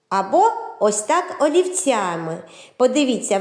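ordinary talk, nothing marked

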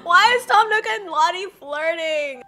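loud, high squeal of mock outrage; shrieked disbelief. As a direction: shriek it like a scandalized fan. Mock outrage at characters flirting.

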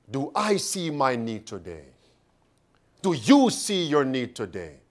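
low, hushed, searching question; solemn, soul-searching softness; quiet reflection. Real, not acted. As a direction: drop soft and low, leaning on "I" and "you". Preacher turns to solemn self-examination.

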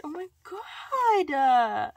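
loud, high, long-drawn exclamation; overwhelmed delight. Real, not acted. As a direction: stretch it out in a thrilled wail. Overwhelmed by gift.

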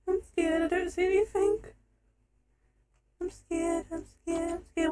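very soft, timid murmur; hushed fear; scared. Real, not acted. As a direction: repeat it quietly in a frightened little voice.

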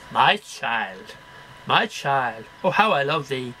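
ordinary talk, nothing marked

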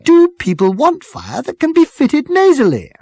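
put-on high, loud, strident girl's voice. A narrator's reading. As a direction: cut in stridently, pitched right up. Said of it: "the girl with the strident voice".

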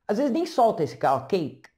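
ordinary delivery

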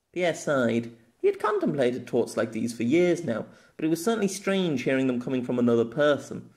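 ordinary delivery

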